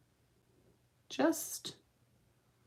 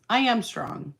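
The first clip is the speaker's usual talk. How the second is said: I pushed up high; stress on I; 5 semitones higher than usual. Real, not acted. Answering a viewer about strength: I stressed. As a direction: lean on I, defiant. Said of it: "You're trying so hard to be strong".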